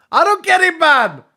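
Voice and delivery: high, loud cry of not getting it; exasperated yell. Pretend. Mock outburst at failed joke.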